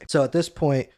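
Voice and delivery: ordinary talk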